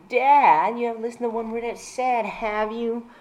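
high, loud start on "Dad"; a shrill telling-off from a teen girl; screeching daughter. Acted. Daughter complaining that dad never listens, high screech. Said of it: "My daughter screeched".

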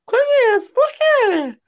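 very high falsetto caricature of the ex; hammed-up catlike falsetto; cat-like mimicked voice. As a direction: mimic him in a squealy high mock voice. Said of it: "Ela imitando o jeito dele".

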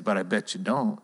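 usual speaking voice

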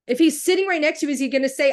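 high, loud, insistent challenge; heated, worked up. Real, not acted. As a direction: press the question hard, worked up. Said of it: "sorry if I sound heated and worked up".

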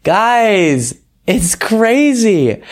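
high, drawn-out disbelief; incredulous. Real, not acted. Host reacts incredulously to clip.

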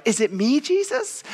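high, squeaky mock disciple; mock anxious question. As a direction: ask it in a very high imitated voice. Voicing disciples asking Jesus.

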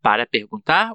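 normal talking voice

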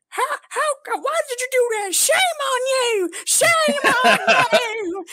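very high, shrill-free falsetto mock scold; old librarian lady scolding. Boy mimicking the librarian who caught a kid damaging a book, high mock scold. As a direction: squeak it out like a scandalized old lady. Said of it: "she was like".

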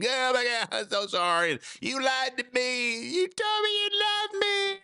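mock bawling woman. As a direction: ham up a jilted woman wailing. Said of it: "just bawled and squalled".

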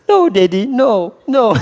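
put-on high, loud child's plea; pleading little girl. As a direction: beg like a small daughter caught out. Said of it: "Saya suka biar dia".

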